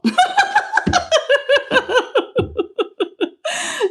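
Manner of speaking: high-pitched cackle that keeps rolling; long high laughing fit. Real, not acted. Streamer cracking up over a mangled viewer name, high laughing fit. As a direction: crack up helplessly in a long run.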